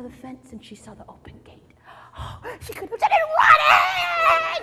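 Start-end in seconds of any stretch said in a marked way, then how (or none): 1.9-2.5 quick high surprised intake; sharp gasp. A narrator's reading. Storyteller to children, gasping in surprise. Sees the open gate. 2.6-4.6 loud, high, slow cry out; excited cry. A narrator's reading. Storyteller to children, crying out excitedly. Sees escape.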